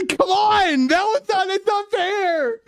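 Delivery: high, loud wail of objection; very high-pitched shouted complaint, last words dragged out; embarrassed protest. Real, not acted. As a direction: cry foul at the top of your voice. Embarrassed outcry at played clip.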